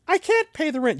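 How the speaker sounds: high, pleading damsel voice; damsel in distress. Acted. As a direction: plead like a helpless silent-film heroine.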